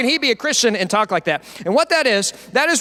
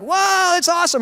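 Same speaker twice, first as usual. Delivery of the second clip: excited; 9 semitones higher than usual. Retold or imitated. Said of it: "they're like".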